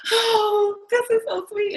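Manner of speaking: very high, weepy squeal; mock bawling. Bride-to-be touched by her fiancé's worry, high mock bawling. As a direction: imitate being moved to tears, hammed-up. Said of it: "I'm bawling now".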